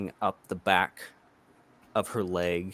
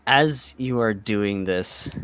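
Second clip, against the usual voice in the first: ordinary talk, nothing marked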